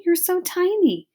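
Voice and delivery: high, sweet princess cooing. Acted. Princess to the tiny mouse, high sweet coo. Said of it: "said the princess to Despereaux".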